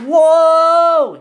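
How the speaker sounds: long, loud, high whoa; high drawn-out holler; shout. Acted. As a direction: shout it out as you're thrown. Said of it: "he shouted".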